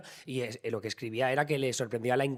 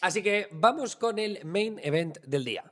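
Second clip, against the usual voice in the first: announcer voice; 6 semitones higher than usual. Acted. Introducing the main story.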